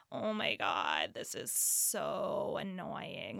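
put-on low, slow, nasal valley-girl drawl; nasal Kardashian voice. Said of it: "super nasally disgusting".

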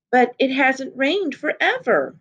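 put-on a small girl's worried plea; alarmed. A narrator's reading.

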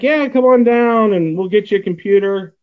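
ordinary talk

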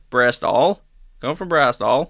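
rough stab at Bristolian; farmer-like Bristol burr; badly attempted West Country accent. Shown as a demonstration. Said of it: "try a Bristolian accent".

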